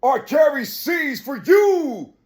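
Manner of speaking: fiery sermon cadence rising to shouts; preaching. Acted. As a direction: preach it hard to the crowd. Preaching at full force.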